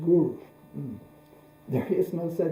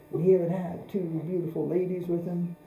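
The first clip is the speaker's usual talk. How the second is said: ordinary talk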